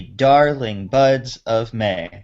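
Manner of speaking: slow, DAR in DARLING, BUDS and MAY stressed; natural iambic stress. Shown as a demonstration. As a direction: lean on BUDS and MAY, keep OF light. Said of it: "it has to be".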